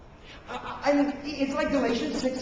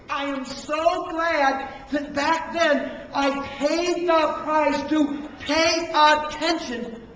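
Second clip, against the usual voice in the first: slow, raised, weighted words; emphatic testimony; 4 semitones higher, 6 dB louder, much slower than usual. Real, not acted. Preacher looking back glad he paid the price, slow emphatic delivery. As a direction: stretch and stress each key word.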